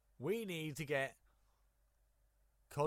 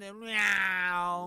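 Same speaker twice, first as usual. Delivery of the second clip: silly meow; 5 semitones higher, 8 dB louder than usual. Pretend. Silly sound effect.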